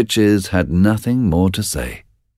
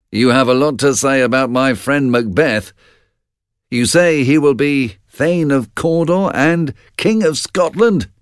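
bold, a touch higher questioning; boldly; 8 semitones higher than usual. Acted. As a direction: challenge the witches boldly. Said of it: "he said boldly".

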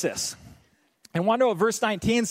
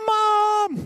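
high, loud wail for mom; hammed-up kid's cry for help; little kid yelling; 7 dB louder than usual. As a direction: holler like a tattling child. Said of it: "when you're a little kid".

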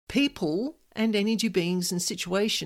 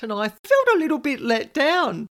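ordinary delivery

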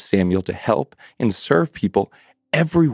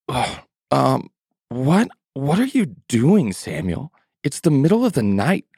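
put-on groggy old priest; 4 semitones higher than usual. Eli woken in the night by Samuel, groggy. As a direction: sound half-asleep and mildly annoyed.

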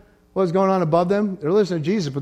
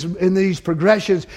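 normal talking voice

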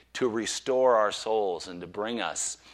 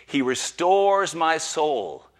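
slow, lifted, louder reading; solemn recital; 4 semitones higher, 6 dB louder, much slower than usual. Acted. Preacher opening with the psalm, slow and full. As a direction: proclaim it slowly.

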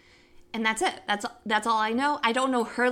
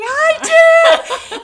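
very high, loud squeal; frantic admission; 15 dB louder than usual. Retold or imitated. Confessing to laughing friends on speakerphone, frantic. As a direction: shriek it in mock despair.

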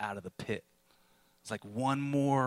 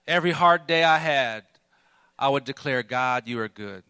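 louder preacher's proclamation; ringing declaration; 2 semitones higher, 8 dB louder than usual. Acted. Pastor declaring faith to the church, ringing. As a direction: declare it like a creed.

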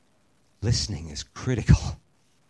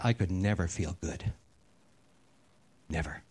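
low and much softer, heavy; hushed confession; 4 semitones lower, 6 dB quieter than usual. Retold or imitated. Preacher voicing the man at the bar, hushed and low. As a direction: drop the voice for the grim admission. Mercenary's dark confession retold.

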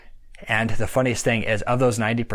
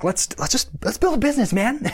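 much higher, eager pitch to a brother; excited pitch; 10 semitones higher than usual. Retold or imitated. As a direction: pump him up about the idea.

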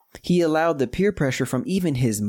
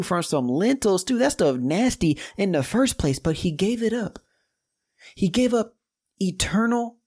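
preaching at full force; 6 semitones higher than usual. Real, not acted. Preacher builds to climax.

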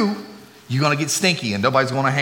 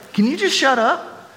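exasperated; 10 semitones higher than usual. Retold or imitated. Exasperated thought about family.

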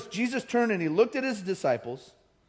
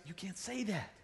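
much softer mock reprimand; hushed rebuke; 15 dB quieter than usual. Peter grabbing Jesus to correct him, hushed. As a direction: pull someone aside and scold under your breath.

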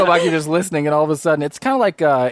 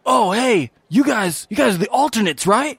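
eager student voice; 6 semitones higher than usual. Acted. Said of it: "and they say".

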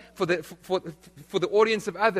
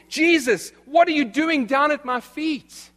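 high, loud, indignant challenge; Peter's pride, protesting with raised voice; proud protest; 8 semitones higher, 4 dB louder than usual. Acted. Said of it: "notice is Peter's pride".

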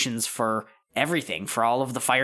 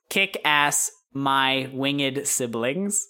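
slow, spaced-out words; writing aloud; much slower than usual. Acted. Girl writing in a notepad, slowly sounding out the message. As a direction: say each word slowly as if writing it. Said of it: "takes the notepad from you and writes".

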